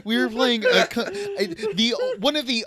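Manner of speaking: indignant rant cracking into laughter; laughing mock rant. Host scolds Matt for eating, breaking into laughter. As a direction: scold him but lose it laughing.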